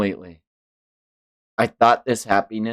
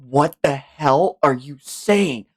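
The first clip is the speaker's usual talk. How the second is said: ordinary delivery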